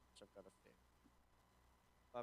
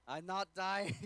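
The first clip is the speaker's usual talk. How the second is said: yelled in triumph; excited shout; 8 semitones higher, 14 dB louder, slower than usual. Real, not acted. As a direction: shout it out, thrilled.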